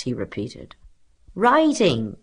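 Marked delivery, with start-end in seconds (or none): 1.3-2.2 high, louder classroom call; scholars' cry. A narrator's reading. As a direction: cry out the answer as pupils. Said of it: "cried the scholars".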